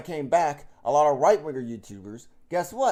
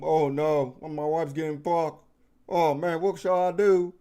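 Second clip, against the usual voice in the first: mock distress. Mocking the cuck role he can't fake, with hammed-up dismay. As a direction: play a husband pretending to be upset. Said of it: "I'll be like".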